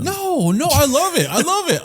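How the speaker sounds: high-pitched, emphatic denial; insistent protest. Real, not acted. Denying he gets upset at friend's fame, insistent protest. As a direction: protest at once, voice jumping up.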